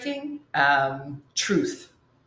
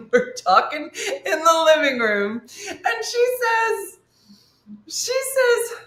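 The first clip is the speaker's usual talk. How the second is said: laughing, much higher pitch; 12 semitones higher than usual. Real, not acted. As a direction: giggle through the words. Laughing while telling story.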